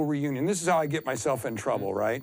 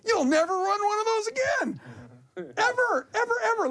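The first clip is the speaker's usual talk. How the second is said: scolding woman. Retold or imitated. Old record holder dismissing his mile plan, imitated high and emphatic. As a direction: imitate her in a high, adamant voice. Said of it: "And she goes".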